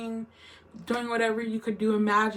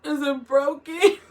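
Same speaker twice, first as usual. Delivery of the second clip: panicked question; 5 semitones higher, 6 dB louder, slower than usual. Retold or imitated. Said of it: "I was like".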